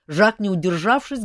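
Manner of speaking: ordinary delivery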